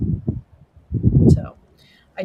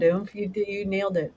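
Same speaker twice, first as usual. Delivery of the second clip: ordinary delivery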